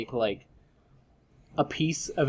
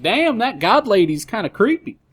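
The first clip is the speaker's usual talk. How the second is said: Southern dude voice; 9 semitones higher, 6 dB louder than usual. Acted. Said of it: "cuts to him being like".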